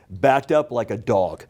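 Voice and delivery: ordinary talk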